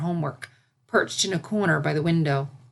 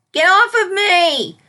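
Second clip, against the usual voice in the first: high, loud panicked scream; 13 semitones higher, 9 dB louder, slower than usual. Acted. Filly screaming at a fish on her tail. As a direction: shriek it at the clinging fish. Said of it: "Sunset screamed".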